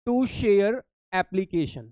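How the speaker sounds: very slow, word-by-word dictation; long gaps between words, dictating. Real, not acted. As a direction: draw out each word as you write it. Dictating the entry while writing it.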